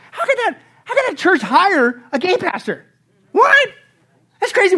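high and loud hammed-up disbelief; mock incredulous. Christians gossiping about another church, mock incredulous. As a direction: shriek the What in mock shock. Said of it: "we scratch our heads and we go like".

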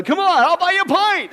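a light, hearty mock pastor offering a pint; lightly voiced jolly pastor. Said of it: "That's what the pastor said".

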